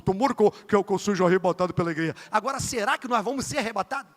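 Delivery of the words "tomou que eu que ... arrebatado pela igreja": imitating a pastor, pitched lower; Reginaldo's deep voice. Preacher mimicking pastor Reginaldo's prayer, low imitation. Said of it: "falando grosso".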